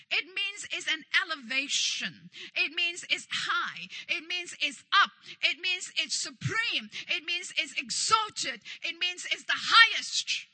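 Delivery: emphatic sermon list, building to a loud peak; building preaching. Real, not acted. Preacher explains Elyon to congregation, building preaching.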